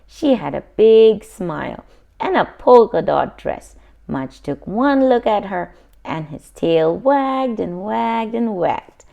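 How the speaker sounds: ordinary delivery, nothing marked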